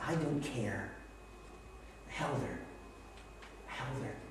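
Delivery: very soft, low voice; tender hush. Retold or imitated. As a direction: recall it tenderly, almost under the breath. Tenderly retelling holding his sick wife.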